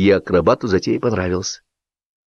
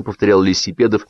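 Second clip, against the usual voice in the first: ordinary delivery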